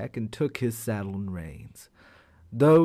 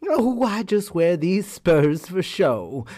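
higher, slow innocent voice; sly hare; 6 semitones higher than usual. Acted. Sly hare reassures the tiger in a higher voice. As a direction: play it all innocent.

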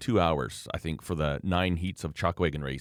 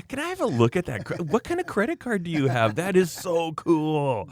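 much higher, gushing voice of a tipsy woman; impressed tipsy woman; 10 semitones higher than usual. Retold or imitated. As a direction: gush like a tipsy stranger amazed by a credit card. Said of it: "She goes".

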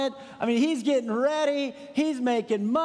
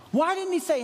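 normal talking voice

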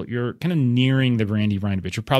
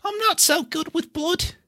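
high, nervous hobbit voice; squeamish hobbit. Acted. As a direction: shrink from the blood. Hobbit squeamish about blood.

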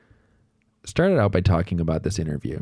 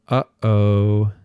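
a lightly voiced toddler going uh-oh, a little louder; toddler's uh-oh; 6 dB louder than usual. Dad imitating his toddler after the milk spill, lightly voiced toddler uh-oh. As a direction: imitate a little kid who knows he messed up.

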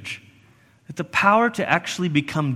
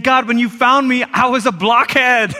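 louder, higher cry of "blockhead"; comic outburst; 6 semitones higher, 4 dB louder than usual. Acted. Preacher voicing the psalmist's loud comic confession.

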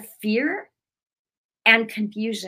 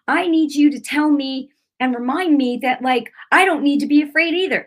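loud, raised-pitch plea, reenacted; retold tearful plea; 6 semitones higher, 5 dB louder than usual. Wife pleading with husband for reassurance, retold tearful plea. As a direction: plead at higher pitch, without real tears. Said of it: "started crying and I was like".

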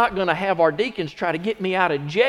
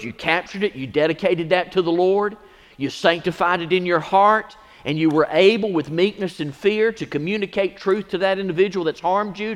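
usual speaking voice

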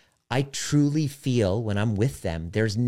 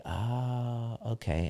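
soft, drawn-out oh; hushed, accepting reply; retold realisation; 7 dB quieter, much slower than usual. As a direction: let it sink in quietly. Retold reaction to barked orders.

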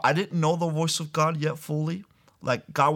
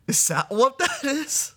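high, slow, wide-eyed question; surprised child; 12 semitones higher than usual. Retold or imitated. Young Freddie answering his mom, high and surprised. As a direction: sound amazed and a little unsure.

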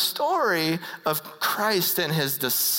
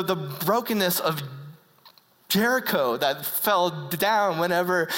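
usual speaking voice

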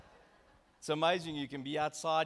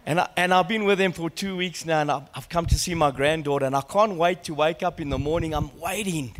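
forceful personal testimony; loud, proud storytelling from the pulpit; loud preaching; 11 dB louder than usual. Real, not acted. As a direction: project it strongly to the whole church. Preaches loudly with personal illustration.

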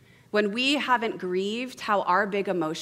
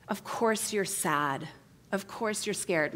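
slow, soft reassurance; tender validation; 4 dB quieter, slower than usual. Acted. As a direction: acknowledge the feeling gently. Acts a comforting, containing listener.

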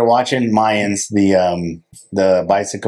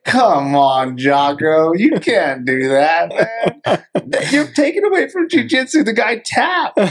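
mock outraged protest; 9 semitones higher than usual. Viewer scolding Jocko for breaking an arm, mock outrage. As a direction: plead in exaggerated squeaky indignation. Acts out laughing protest at screen.

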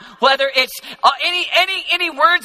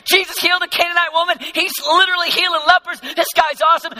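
raised, high-pitched acted rant; excited scolding; 8 semitones higher, 3 dB louder than usual. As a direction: shut them up and champion your hero. Jairus voiced defending Jesus to mourners.

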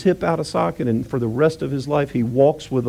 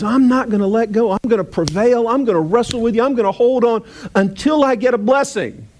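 higher, stubborn Jacob refusing to let go; defiant Jacob; 7 semitones higher than usual. Retold or imitated. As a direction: hold on like a man who won't quit. Jacob voiced defiant in story.

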